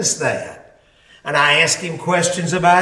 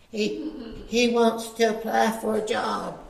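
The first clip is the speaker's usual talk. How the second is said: put-on little girl; 4 semitones higher, 8 dB quieter than usual. Girl tells owner about applicant.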